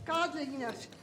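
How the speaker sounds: talking from well away from the mic; far from the microphone. Real, not acted. Speaker accuses the board from far off the mic. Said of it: "we can't hear you unless you stand in front of the microphone".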